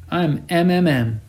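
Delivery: put-on a cabbie's singsong northern Argentine accent; sing-song northern accent. A narrator's reading. Taxi driver chatting to his passenger, northern Argentine sing-song accent. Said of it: "in the sing-song accent of the northern provinces".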